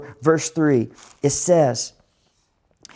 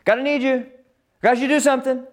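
desperate plea; 9 semitones higher, faster than usual. Acted. Voicing desperate prayer to God.

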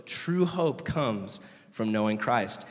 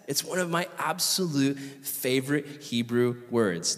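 usual speaking voice

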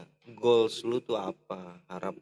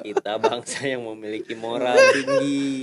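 mock moral lecture; 4 dB louder than usual.

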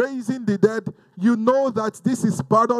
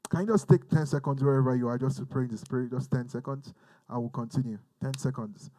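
soft prayer call; 7 semitones lower, 9 dB quieter than usual. Real, not acted. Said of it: "leads prayer softly".

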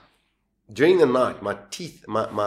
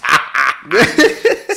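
laughing; 6 semitones higher, 11 dB louder than usual. Real, not acted. Laughing at the prank.

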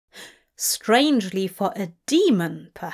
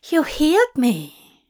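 high, loud amazed exclamation; higher, louder cry of wonder; exclaimed; 6 semitones higher, 5 dB louder than usual. A narrator's reading. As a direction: burst out in delighted disbelief. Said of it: "he exclaimed stupidly".

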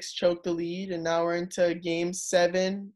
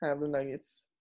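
low-key, softly spoken reluctant admission; 3 semitones lower, 9 dB quieter than usual. Real, not acted. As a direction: admit it quietly, against your will. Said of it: "As much as I don't wanna say it".

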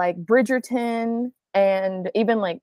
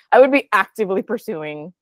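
usual speaking voice

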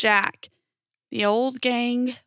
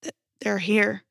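huffed. A narrator's reading. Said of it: "Arjun huffed".

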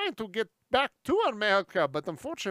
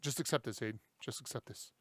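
mock soothing; 7 semitones lower, 12 dB quieter than usual. Soothing friend in lap.

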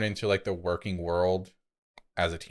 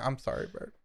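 soft whispered apology; whispered I'm sorry; whispered sympathy; 3 semitones higher, 9 dB quieter than usual. Real, not acted. Sympathy after sad story.

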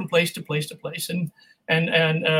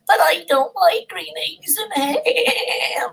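high, very slow, complaining little goat; whiny goat voice; 10 semitones higher, much slower than usual. Shown as a demonstration. Voice actor demoing the poor little goat, slow whine. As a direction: drag it out like a sulky goat. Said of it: "for Green Eggs and Ham".

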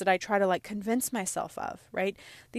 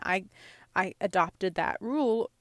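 ordinary talk

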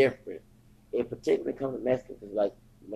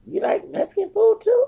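mock disbelief; 5 dB louder than usual. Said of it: "They're like".

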